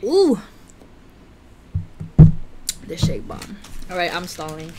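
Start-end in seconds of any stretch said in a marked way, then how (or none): 0.0-0.4 high, loud rising-and-falling whoop; impressed "ooh". Real, not acted. Vlogger reacting to the strawberry shake, delighted.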